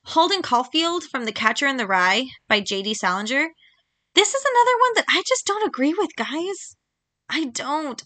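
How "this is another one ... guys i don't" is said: much higher pitch, pleading with the audience; exasperated. Real, not acted. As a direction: complain to the viewers in disbelief. Exasperated rant about the book.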